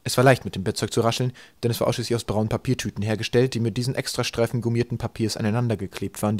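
ordinary delivery, nothing marked

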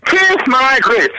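high, drawn-out sassy catchphrase. Retold or imitated. Quoting an old American program, high drawn-out catchphrase. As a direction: belt it slowly, pitched right up. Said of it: "they used to say".

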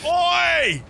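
high, loud sharp "Oi!"; mock boss yell. Foreman yelling at a mate on the work site, mock angry shout. Boss scolding reckless worker.

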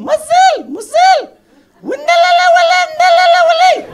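very high, loud caricature shrieks; imitated shouting. Older woman suddenly calling out to a friend, mock shouting. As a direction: shout high gibberish on one pitch. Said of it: "הן מדברות רק בצעקות".

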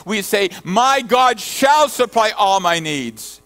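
fervent preacher climbing in pitch and volume; rising preaching. Acted. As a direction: drive it home from the pulpit. Pastor preaching at full force.

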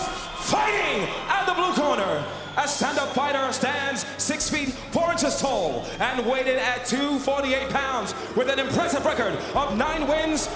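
ordinary talk, nothing marked

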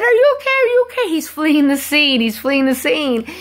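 high, loud urgent questions easing slightly into warning; high-pitched retold alarm; alarmed bystander calling out. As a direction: act out a frantic passer-by shouting over. Bystander in van calls out, alarmed.

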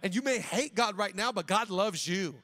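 loud, driving sermon delivery; fervent preaching. Real, not acted. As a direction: preach it with firm, forceful conviction. Preaching at full force.

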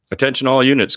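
loud mock radio call. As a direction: announce it like a commander. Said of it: "Curtis cries out".

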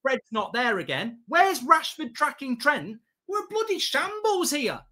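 ordinary talk

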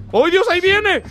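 ordinary talk, nothing marked

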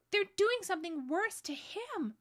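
high, loud distressed cry; panicked child's wail; horrified. A narrator's reading. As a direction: cry out in horror for a friend. Lucy horrified for Aslan.

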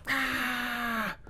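imitating customer. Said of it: "She's like".

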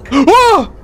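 high, loud shocked whoa; startled gasp. Real, not acted. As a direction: jump in fright. Jump scare again.

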